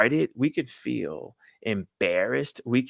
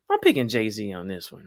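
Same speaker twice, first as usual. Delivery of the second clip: normal talking voice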